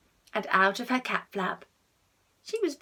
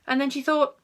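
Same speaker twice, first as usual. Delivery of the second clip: plain reading voice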